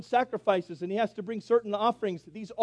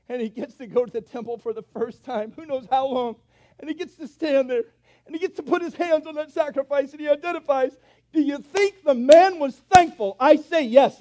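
louder and higher, building to a fervent climax; preaching at full force; 8 semitones higher, 10 dB louder than usual. Acted. Preacher to the congregation, building to a loud, fervent climax. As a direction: preach it out, rising with every clause.

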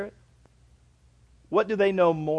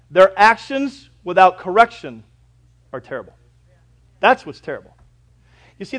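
ordinary delivery

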